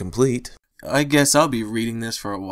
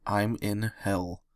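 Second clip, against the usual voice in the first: much softer and slow, utterly defeated; flat despair; 9 dB quieter, much slower than usual. A narrator's reading. Andrew repeating to himself in flat despair. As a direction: let it sink in, slow and hollow. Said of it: "I thought flatly".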